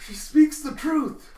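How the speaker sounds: high, loud, drawn-out mock astonishment; awestruck revelation. Teacher stunned that humans are animals, awestruck cry. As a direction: proclaim it as a staggering discovery.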